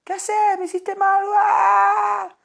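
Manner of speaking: put-on upset child. Child scolded by a loving father, high crying protest. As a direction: yelp like a kid slapped on the fingers. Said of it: "Y me voy a enojar".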